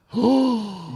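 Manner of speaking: sharp loud mock gasp of shock; retold shocked gasp. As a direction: gasp like you just got punched in the eye. Hit in his good eye.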